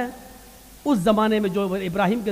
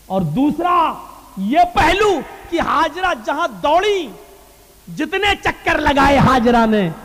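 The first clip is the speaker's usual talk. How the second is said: raised, swinging sermon cadence; emphatic preaching; 7 semitones higher, 5 dB louder than usual. Real, not acted. Preacher presenting the second point about Hajra, emphatic preaching. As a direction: announce the next point with rising fervor.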